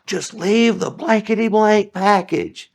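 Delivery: angry yell, drawn out; yelling customer. Retold or imitated. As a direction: bellow it at the delivery guy. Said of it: "they just yell at me".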